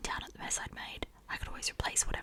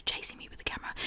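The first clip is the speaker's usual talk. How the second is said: usual speaking voice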